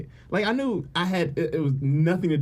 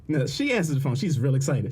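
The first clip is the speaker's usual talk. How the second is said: ordinary talk, nothing marked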